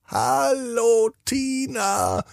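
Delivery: sehr hoch und sanft rufend; hohe Sternenstimme. Acted. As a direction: wie ein Stern aus der Ferne, hoch und leise. Geheimnisvolle Stimme ruft Tina. (ein Stern ruft Tina sanft durchs Dachfenster, sehr hoch)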